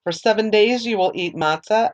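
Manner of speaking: ordinary delivery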